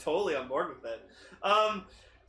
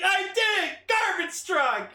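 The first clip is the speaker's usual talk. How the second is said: much higher, louder, slow proud yell; triumphant shout; 11 semitones higher, 4 dB louder, slower than usual. Retold or imitated. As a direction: announce it with wild triumph. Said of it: "He's like".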